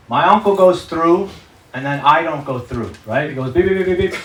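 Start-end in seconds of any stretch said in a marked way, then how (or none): 3.5-4.2 rapid high-pitched mock alarm beeps; metal detector beeping. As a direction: imitate a metal detector going off. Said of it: "there's a metal detector".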